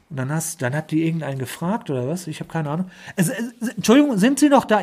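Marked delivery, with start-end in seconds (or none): 3.2-4.8 mimicking agent. Agent voice returning.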